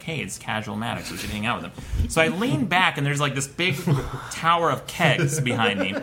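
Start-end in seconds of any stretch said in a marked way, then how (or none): 0.0-2.1 low, soft, casual mock-cool voice; trying to be cool. Lonely traveler acting unbothered before strangers, trying to be cool. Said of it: "try to be cool and show them".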